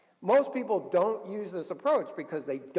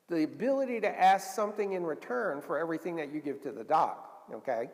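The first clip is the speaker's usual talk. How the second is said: ordinary talk, nothing marked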